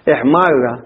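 إحمارة with the ra held long and doubled. Shown as a demonstration. Teacher showing students the shadda, stressed doubled ra. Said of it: "را تشدد".

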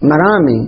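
"مرامي" ending in a long ee, no n; ee ending without tanween. Shown as a demonstration. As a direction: say "مرامي" with a falling long ee at the end. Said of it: "هذا خطأ". («مرامي» بياء ممدودة دون تنوين)

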